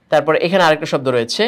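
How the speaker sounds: ordinary delivery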